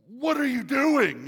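high, slow, very loud demand; hammed-up husband yelling in disbelief; outraged yell. Husband confronts wife in retold quarrel.

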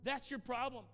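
high, loud, falling; loud retold insistence; emphatic insistence. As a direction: hammer it home. Pastor pressing his point.

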